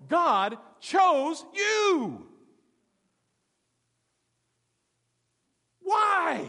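slow, drawn-out, high and loud; booming preach. Real, not acted. Pastor booming to his congregation, slow and loud. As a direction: hammer the point home to the church.

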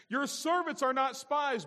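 high, loud, slow protest; hammed-up denial; pleading denial. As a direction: stretch out a loud plea of innocence. Brothers deny the accusation.

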